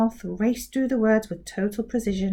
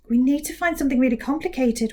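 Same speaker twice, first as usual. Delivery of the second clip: plain reading voice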